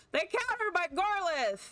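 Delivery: very high indignant cry; aggrieved squeal of disbelief; outraged wail. Real, not acted. Upset at losing his card.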